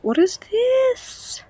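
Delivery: slow, very high question; surprised squeal. Real, not acted. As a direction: squeak it out, drawn out. Surprise at an unboxed item.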